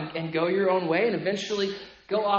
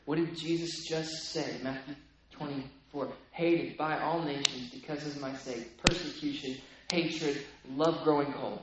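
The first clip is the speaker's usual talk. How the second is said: hushed; 6 semitones lower, 11 dB quieter than usual. Real, not acted. Quiet recap.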